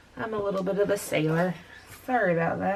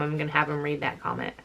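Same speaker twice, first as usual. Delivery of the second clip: normal talking voice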